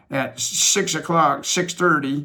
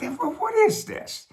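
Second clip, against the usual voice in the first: bewildered question. Retold or imitated. Bewildered young self questioning.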